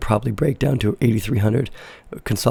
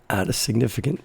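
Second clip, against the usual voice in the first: normal talking voice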